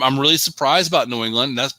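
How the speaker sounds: ordinary talk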